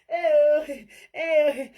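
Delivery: hammed-up fake sobbing "eh"; mock crying. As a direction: imitate a whiny fake cry. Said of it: "Make I go cry".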